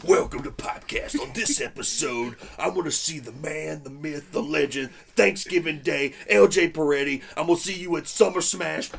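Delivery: loud, hyped promo shout; wrestling promo. Acted. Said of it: "every intro does feel like a wrestling promo".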